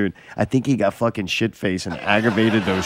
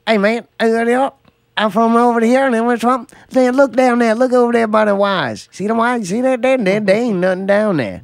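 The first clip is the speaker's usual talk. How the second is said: high, louder goofy guy voice; weird put-on voice; 13 semitones higher, 4 dB louder than usual. Retelling a party guy's weird voice, high goofy imitation. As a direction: imitate the drunk buddy's strange high voice. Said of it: "He's obviously doing a voice".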